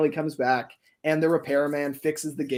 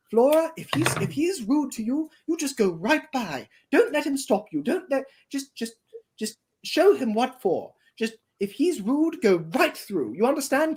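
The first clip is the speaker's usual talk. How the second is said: high coaching voice; 10 semitones higher than usual. Acted. Gale coaches Flora, high voice.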